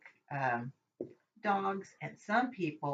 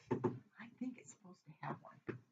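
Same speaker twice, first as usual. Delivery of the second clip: whisper; 16 dB quieter than usual. Real, not acted. Unsure aside to herself.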